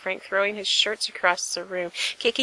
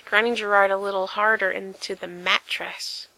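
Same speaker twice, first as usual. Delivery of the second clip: usual speaking voice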